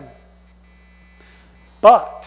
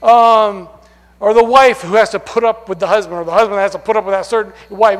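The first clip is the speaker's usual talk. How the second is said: usual speaking voice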